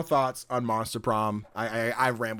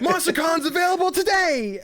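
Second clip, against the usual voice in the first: high, loud excited cry; 4 dB louder than usual. Real, not acted. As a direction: shout the news with glee. Excited at news.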